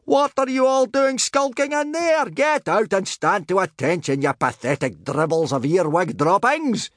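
high sergeant-major bellow, falling in pitch; roared dressing-down. A narrator's reading. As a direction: bark orders and insults at slackers. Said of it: "bellowed Gobber".

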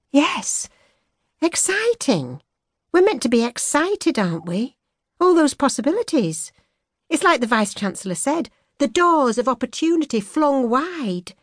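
put-on young woman musing aloud; young woman's voice. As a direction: voice her bright and searching. She defiantly repeats, high.

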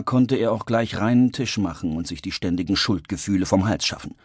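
usual speaking voice

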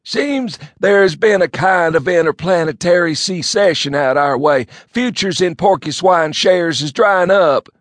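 high, loud, slow country bumpkin; bucolic drawl. A narrator's reading. Elmo telling his cousin the news in a loud bumpkin voice. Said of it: "his bucolic voice".

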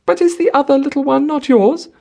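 put-on high, loud, childlike pleading; wilful, spoiled woman persisting tearfully; tearful insistence. A narrator's reading. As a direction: ask through tears, pitched right up. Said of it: "she asked, through her tears".

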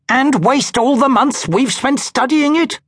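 appalled at the idea; indignant disbelief; scandalized. A narrator's reading.

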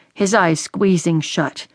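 ordinary delivery, nothing marked

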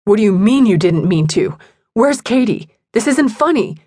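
understated angry yelling; upset yell. A narrator's reading. Tyler demanding to know where Katie is, upset yell. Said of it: "Tyler yelled".

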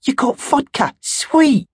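put-on high, gleeful teen girl voice; thrilled at the secret booze; high excited girl; delighted squeal. Barbara delighted at vodka.

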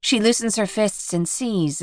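ordinary delivery

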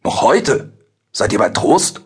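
aghast questions, mildly exclaimed; a touch of stunned disbelief; entgeistert. A narrator's reading. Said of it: "Adam rief entgeistert". (entgeistert gerufen)

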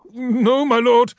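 a cowering clerk stammering excuses; frightened logistician. Acted. Fat logistician begging the War Master's equerry, high frightened voice.

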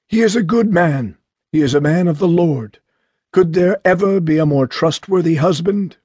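a hint of a frail old parent insisting; weak father. A narrator's reading. Bedridden father urging the marriage on his daughter, weak insistent voice. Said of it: "His voice was weak".

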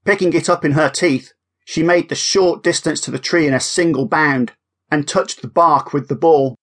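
ordinary delivery

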